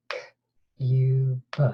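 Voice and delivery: K-U-B whispered, each sound split apart. Shown as a demonstration. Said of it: "Let's do it again".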